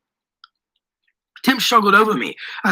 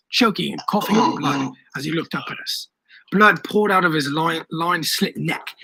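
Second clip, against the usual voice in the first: ordinary delivery, nothing marked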